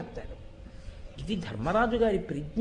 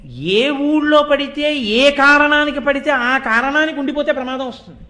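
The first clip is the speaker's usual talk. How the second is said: forceful preaching; 5 semitones higher, 8 dB louder than usual. Real, not acted. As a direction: hammer the warning home word by word. Preacher driving home a point. (గట్టిగా, నెమ్మదిగా ఉద్ఘాటన)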